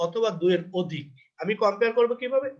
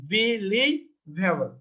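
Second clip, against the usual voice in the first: believable drawn out very slowly, be-lie-va-ble; syllable by syllable; much slower than usual. Shown as a demonstration. Teacher showing why the word takes more, syllable by syllable. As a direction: separate each syllable of believable with small gaps. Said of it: "এক, দুই, তিন".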